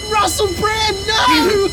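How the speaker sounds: high anguished mock scream; burning scream. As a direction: cry out as he burns. Russell Brand incinerated.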